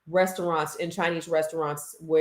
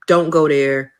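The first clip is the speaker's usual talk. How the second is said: usual speaking voice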